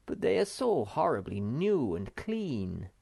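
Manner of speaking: ordinary delivery, nothing marked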